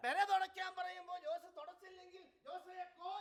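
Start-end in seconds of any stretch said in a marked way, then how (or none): 2.5-3.1 high chicken-like taunt; mock chicken call. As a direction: tease with a raised "Jose കോ". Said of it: "like a chicken over there".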